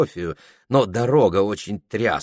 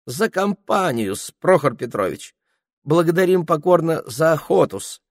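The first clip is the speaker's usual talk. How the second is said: fawning peasant voice; 9 semitones higher, 3 dB louder than usual. Acted. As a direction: play it as a wheedling coachman. Ilya flatters his master.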